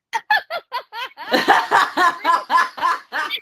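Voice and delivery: big laugh, rising; loud burst of laughter. Real, not acted. Laughing at wordplay.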